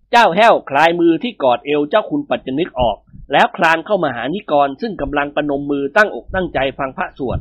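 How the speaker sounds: ordinary delivery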